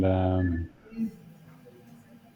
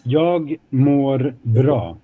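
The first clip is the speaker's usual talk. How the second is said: slow, careful model phrase; each word given its own beat; much slower than usual. Shown as a demonstration. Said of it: "So how do we say it again?".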